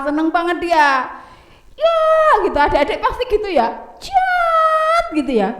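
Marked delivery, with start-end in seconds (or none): 4.0-5.0 sword sound. Shown as a demonstration.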